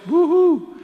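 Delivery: high whooping cheer for Caiaphas; mock cheer. Preacher mocking Caiaphas's crowds with a high whoop.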